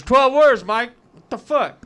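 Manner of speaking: mock a fed-up partner just woken up; pissed off. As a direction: imitate an irritated wife, high and loud. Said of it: "She's pissed off".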